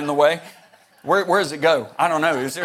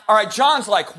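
normal talking voice

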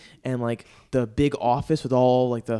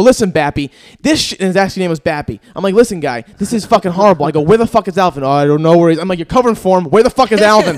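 loud, pressing questions; angry demands; 4 semitones higher, 11 dB louder than usual. Retold or imitated. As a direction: keep hammering him for Alvin. Yelling at the finance guy.

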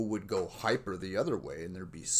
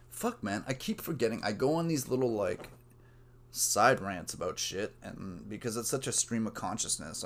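ordinary talk, nothing marked

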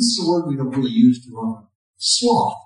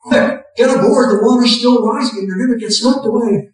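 louder, higher urgent call; shouting urgently; 7 semitones higher, 5 dB louder than usual. Retold or imitated. Second boat crew yelling for man to board, loud urgent shout. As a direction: hurry him aboard with a raised voice. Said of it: "people shout".

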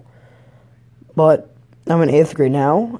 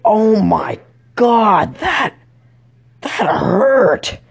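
loud, high, drawn-out yelp; hammed-up cry of pain; mock pained outcry; 7 semitones higher, 4 dB louder, much slower than usual. Stabbed student crying out in class, mock pained. Reenacts reacting to being stabbed.

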